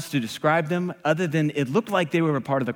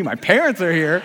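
louder, disbelieving mock alarm; incredulous echo; 2 semitones higher, 5 dB louder than usual. Waiter hearing his parents arrived, acted disbelief. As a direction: throw the news back in disbelief. Stunned repeat of the news.

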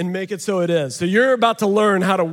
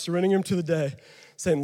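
normal talking voice

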